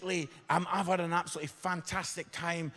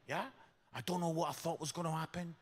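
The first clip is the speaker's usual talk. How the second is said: ordinary delivery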